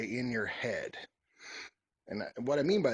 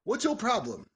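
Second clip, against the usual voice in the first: sharp, high, accusing; accusatory snap; 9 semitones higher, 4 dB louder than usual. Shown as a demonstration. Advising on a partner, a snippy accusing question. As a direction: demand it like an accusation. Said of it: "Don't be like".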